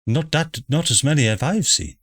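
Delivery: lightly voiced chatty mother; posh mother voice.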